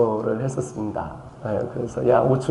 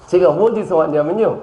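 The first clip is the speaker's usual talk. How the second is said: Chungcheong dialect; 6 semitones higher than usual. Retold or imitated. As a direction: talk like a Seosan villager. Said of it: "충청남도 서산시 부석면 지살리에서 왔습니다". (높은 톤의 충청도 사투리)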